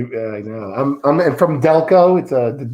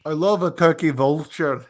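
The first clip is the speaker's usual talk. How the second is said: a wobbly put-on Australian accent drifting toward Scottish; shifting mock Australian accent; 4 semitones higher than usual. Shrek chats with the show host in a shaky mock Australian accent. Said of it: "we're getting you in Scottish, sometimes it's Ukrainian".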